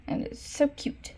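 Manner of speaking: high, breathy, slow coo of delight; soft high gush; gushing whisper. Real, not acted. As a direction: whisper it like it's too cute to bear. Gushing over sister's drawing.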